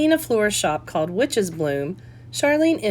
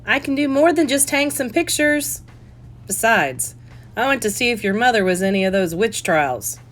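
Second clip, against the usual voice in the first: louder, higher dad calling from the room; father yelling out; 6 semitones higher, 5 dB louder than usual. Acted. Father calling out from the background, louder and higher. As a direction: shout it across the room like dad. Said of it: "her father yell out".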